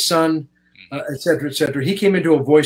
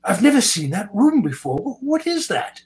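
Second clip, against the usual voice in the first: higher, slow, wide-eyed imitation of a fascinated star; curious Anthony Hopkins; 8 semitones higher, slower than usual. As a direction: play him amazed and curious, drawing the words out. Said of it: "He goes".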